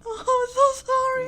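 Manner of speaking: loud, high tearful wail; crying. Real, not acted. Player apologizing as the ending closes, crying. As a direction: cry the apology out. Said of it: "I'm about to cry".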